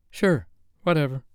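Sophie, dismissive. Acted.

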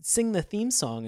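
ordinary talk, nothing marked

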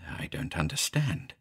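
unvoiced, bewildered whisper. A narrator's reading. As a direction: breathe it out, lost. Confused, reading the note.

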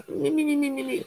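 high squeaky me me me, fading out; a Stooge bleating under duress; Three Stooges whine. Retold or imitated. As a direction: falsetto Stooges whimper. Acting out the porn scene.

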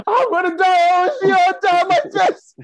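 high, crying wail; imitating sobbing. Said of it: "I'm crying".

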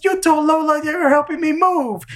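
very high accusing duck; high cartoon accusation; Daffy voice. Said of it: "He goes".

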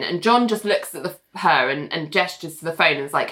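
ordinary talk

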